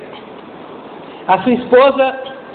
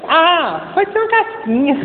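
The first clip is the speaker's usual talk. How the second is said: put-on higher, sweet girlish voice; girlfriend's voice; 7 semitones higher than usual. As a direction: play the modest teen girl, pitched up. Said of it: "Aí ela".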